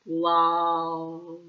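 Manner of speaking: drawn-out, loud, pained "love"; a sorry, sheepish groan; rueful groan. Retold or imitated. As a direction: groan it like a man who regrets everything. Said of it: "The way he said it".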